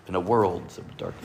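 a hint of a low movie-trailer hero; slightly deepened, hushed epic hero voice. Shown as a demonstration. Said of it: "in my hero voice".

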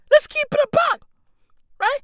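chuckling while he keeps it real; laughing. Real, not acted. Rant to viewers, laughing as he talks.

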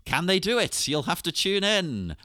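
playful tune-in-next-time voice; mock cliffhanger. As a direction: tease the listeners like a serial announcer. Mock cliffhanger teaser for listeners.

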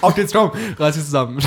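laughing while urging. Real, not acted. Urging partner through laughter.

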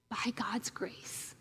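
slow, hushed whisper of hope; whispered encouragement. Real, not acted. Preacher whispers encouragement to the congregation, slowly and softly. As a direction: breathe it out gently to the congregation.

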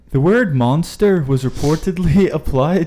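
can't stop laughing while trying to read; laughing fit. Real, not acted. Cracking up over failed accent.

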